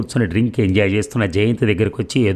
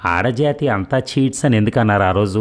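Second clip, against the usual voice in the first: usual speaking voice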